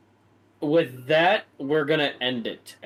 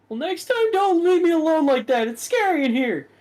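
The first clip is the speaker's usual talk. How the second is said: high whiny complaint, much louder; whining Todrick; 6 dB louder than usual. Acted. Todrick whining at Sarah for leaving him alone, high and whiny. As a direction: whine that she left him alone. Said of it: "He's just, like, whining".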